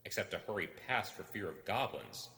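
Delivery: ordinary delivery, nothing marked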